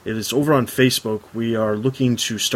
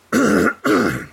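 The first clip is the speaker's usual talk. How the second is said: strained, choked-up voice; struggling through a choke; choking on a drink; 2 semitones higher, much slower than usual. Real, not acted. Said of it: "it went down the wrong pipe".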